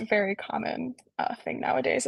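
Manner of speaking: normal talking voice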